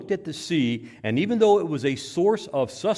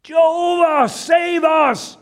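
high, desperate full shout; calling upon God; 11 dB louder, much slower than usual. Acted. Disciples to God in the storm, desperate cry. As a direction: scream the plea to heaven. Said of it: "They were calling upon Jehovah".

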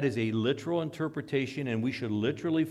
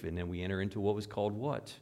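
ordinary talk

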